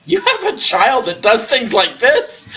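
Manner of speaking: mock outrage breaking into laughter; laughing accusation. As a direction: complain while cracking up. Angry parents accuse Joseph.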